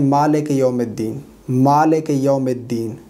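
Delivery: quick spoken "मालिक यौमिद्दीन", as "maleke yomeddin"; common mistake. Shown as a demonstration. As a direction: lax e vowels in "मालिक यौमिद्दीन". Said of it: "बाद लोग गलती करते हैं".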